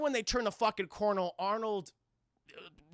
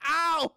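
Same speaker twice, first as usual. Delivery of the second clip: pained yelp; 7 semitones higher, 8 dB louder than usual. Retold or imitated. Arnold hurts his leg.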